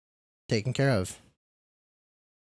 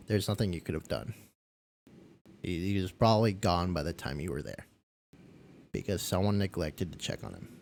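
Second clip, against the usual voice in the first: lower, softer consoling words; reassuring; 4 semitones lower, 4 dB quieter than usual. Retold or imitated. Consoling himself over the client's death, softly reassuring. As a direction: talk yourself gently down.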